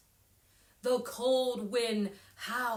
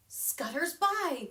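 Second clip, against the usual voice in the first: usual speaking voice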